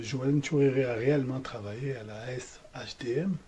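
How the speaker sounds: hushed whisper while waiting. Real, not acted. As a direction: whisper it so the line won't hear.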